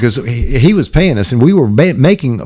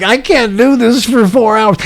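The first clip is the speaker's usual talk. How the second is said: whiny despair; 9 semitones higher than usual. Retold or imitated. Brad's despairing retold complaint.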